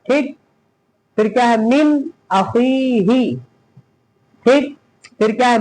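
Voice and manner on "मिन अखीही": "मिन अखीही" recited very slowly; slow, measured model reading of "मिन अखीही"; matan recitation. Shown as a demonstration. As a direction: hold the long vowel in "अखीही" after a clear "मिन". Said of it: "मतन पढ़ेंगे".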